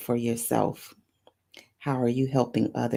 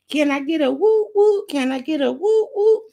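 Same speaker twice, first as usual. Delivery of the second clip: high, loud crowd-pumping call; excited shout for a woo; hype call; 12 semitones higher, 5 dB louder than usual. Real, not acted. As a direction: rouse the audience for a cheer. Calling for crowd cheer.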